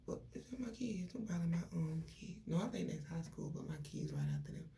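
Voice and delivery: real whisper aside. Real, not acted. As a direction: drop to a whisper mid-story. Hushed aside about her kids.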